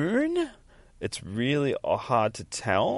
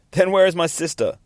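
higher, louder acted question; demanding girl; 8 semitones higher, 6 dB louder than usual. Voices upset girl in comic.

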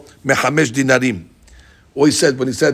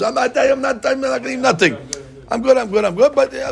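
much higher pitch, imitating a reluctant guest; mock polite refusal; 10 semitones higher than usual. Guest who doesn't want to impose, mock polite refusal. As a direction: beg off in a high, insistent imitation.

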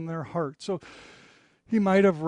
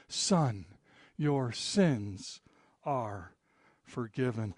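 softer, hushed words of forgiveness; soft pronouncement; 8 semitones lower, 7 dB quieter, slower than usual. Acted. Jesus quietly forgives the paralyzed man, soft and gentle.

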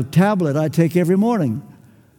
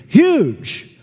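huge punched louder with a falling tune; emphatic huge; 4 semitones higher, 4 dB louder than usual. Real, not acted. Preacher to congregation, loud emphatic huge. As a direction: slam the word huge.

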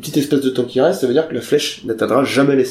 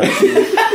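laughing. Real, not acted. Laughs at own impression.

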